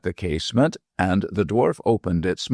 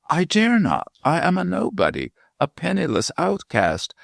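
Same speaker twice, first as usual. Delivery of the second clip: high-pitched, anguished self-abasement; lovelorn, high-pitched despair; 11 semitones higher than usual. A narrator's reading. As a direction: plead your unworthiness in a high voice. Lovesick Warwick despairs of wooing Celia.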